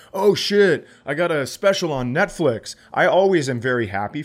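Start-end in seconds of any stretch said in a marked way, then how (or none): 0.1-2.7 mock excited, higher and louder start; friend's excited news. Friend announcing Netflix special, lightly excited. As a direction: play a thrilled comic sharing good news. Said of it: "a friend going like".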